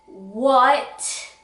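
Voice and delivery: normal talking voice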